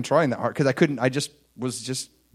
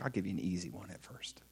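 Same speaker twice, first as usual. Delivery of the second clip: soft unvoiced whisper; God whispering a promise; 7 semitones lower, 16 dB quieter than usual. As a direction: confide it in a hush. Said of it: "He's like".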